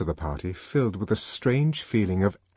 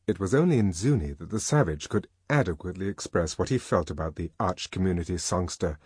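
plain reading voice

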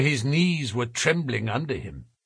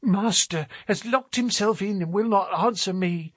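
put-on a touch higher, anxious old woman; worried housekeeper; 7 semitones higher than usual. A narrator's reading. Housekeeper telling Thaddeus of her master, lightly anxious. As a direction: explain in a worried rush. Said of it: "she explained".

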